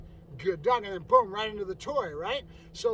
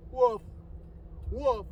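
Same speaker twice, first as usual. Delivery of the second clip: weak dog barks; 3 semitones higher, much slower than usual. Retold or imitated. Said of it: "blind and going".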